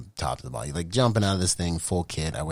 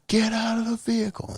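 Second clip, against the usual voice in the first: calling out; 3 dB louder than usual. Retold or imitated. Calling to men in vehicle.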